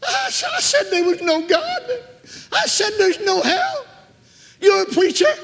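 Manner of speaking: very high, loud, drawn-out desperate pleading; terrified wailing. Retold or imitated. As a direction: wail and beg in mortal terror. Dying woman terrified of hell.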